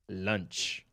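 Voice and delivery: low, soft deadpan tiger; lightly voiced predator cat announcing lunch. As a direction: growl it low as the hungry tiger. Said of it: "comes around the corner and is like".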